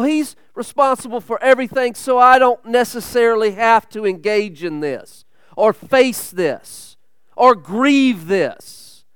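ordinary talk

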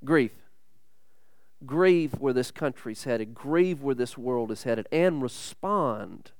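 a preacher voicing Jesus in a hushed plea. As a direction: plead quietly, letting each grieve sink in. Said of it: "And I believe he would say".

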